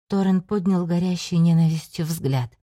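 ordinary delivery

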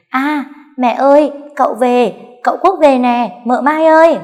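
high, loud calling out from the lane; shouting. Acted. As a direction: yell the news across the yard. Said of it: "hét ầm lên".

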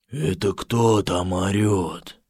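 low, slow, hollow voice; grim reaper voice. Acted. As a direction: speak like a voice from the grave. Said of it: "глухо произнёс".

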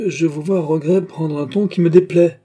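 high, loud indignant protest; cocky, bristling. A narrator's reading. Beausire objects to the Portuguese's tone, cocky and bristling. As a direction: puff up like a rooster raising its spurs. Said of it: "avec l'accent d'un coq qui monte sur ses éperons".

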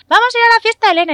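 put-on high, loud, fast little-girl voice; imitating a toddler. The aunt voices her little niece asking about the party, high and fast. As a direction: squeal it like an excited two-year-old.

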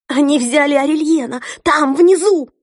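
high, loud panicked outburst; panicked cry. Acted. Sister repeats, now panicking.

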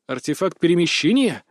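ordinary delivery, nothing marked

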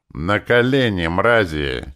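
loud domineering order; a strange imperious voice; commanding alien voice. Acted. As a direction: command them down like a tyrant. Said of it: "чужим властным голосом".

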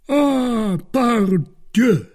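Porthos's high, loud, drawn-out cry; hearty exclamation. A narrator's reading. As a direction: hail your friends high and loud. Porthos greets his arriving friends.

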